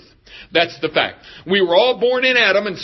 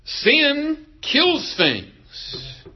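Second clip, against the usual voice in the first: very slow emphasis; 5 semitones higher, much slower than usual. Real, not acted. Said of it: "preacher slows for emphasis".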